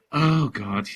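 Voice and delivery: ordinary talk, nothing marked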